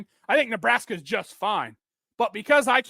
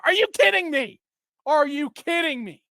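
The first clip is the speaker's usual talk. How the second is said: exasperated disbelief; 9 semitones higher, 3 dB louder than usual. Real, not acted. Host venting at fans attacking the coach, incredulous and higher. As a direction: pitch jumps way higher in fed-up disbelief.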